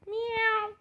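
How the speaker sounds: high cat yowl; cat meow. Acted. Cat noise.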